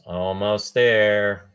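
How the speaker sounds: slow, drawn-out pilot's almost there; Star Wars quote. Pretend. Host quoting Star Wars while the guest reconnects, slow and tense. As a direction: stretch it out like a tense X-wing pilot. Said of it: "I'm gonna quote Star Wars here".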